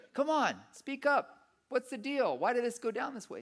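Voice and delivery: high-pitched exasperated questioning of God; frustrated demand. Retold or imitated. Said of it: "I remember being so frustrated".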